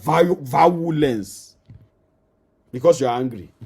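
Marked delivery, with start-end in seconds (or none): 0.0-1.6 violence drawn out slowly as "va-wu-lens"; false start, then stretch violence into va-WU-lens; Nigerian pronunciation. Shown as a demonstration. Preacher showing Nigerian parlance, violence slowly said "vawulens". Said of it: "in our Nigerian parlance".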